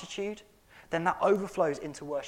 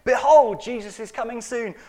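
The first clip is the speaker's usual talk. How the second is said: behold thrown out loud and high; loud proclamation; 7 semitones higher, 13 dB louder than usual. Real, not acted. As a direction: cry it out like a herald. Repeated refrain, loud version.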